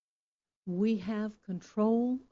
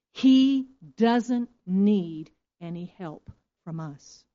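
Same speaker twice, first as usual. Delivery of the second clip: ordinary delivery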